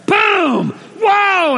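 high, loud punch and yelp; startled outburst. Acted. Hit by the coworker an hour later, startled. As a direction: explode it, then yelp in shock.

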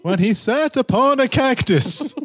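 put-on a sweet old lady telling a naughty secret, high. Asked to keep talking like the old woman, high and sweet. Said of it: "Could you talk like that for the rest of the time".

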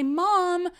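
put-on high, pleading teen whine to mom; whiny teenager. Teenage daughter begging her mom for a tattoo, high whine. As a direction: beg like a sulky teenager. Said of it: "come to my mom as a teenager and say".

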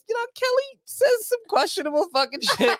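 high, laughing squeal. Real, not acted. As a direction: laugh through the words. Laughing through the line.

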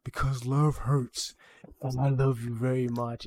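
lightly voiced demon, slowed right down; demonic voice. Demonic rabbit answering the character it slapped, slow demonic voice. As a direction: draw out the opening words with menace. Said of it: "like, the demonic voice".

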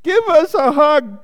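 high, loud storybook call; crying out. A narrator's reading. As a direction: cry out eagerly at the top of the voice. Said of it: "cried Douglas".

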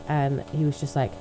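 ordinary talk, nothing marked

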